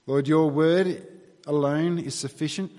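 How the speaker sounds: ordinary talk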